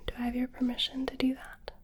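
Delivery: ordinary delivery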